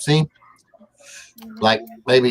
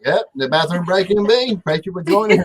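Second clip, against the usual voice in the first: mock announcer; 6 semitones higher than usual. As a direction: greet like a show host.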